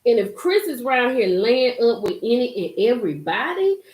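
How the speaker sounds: ordinary talk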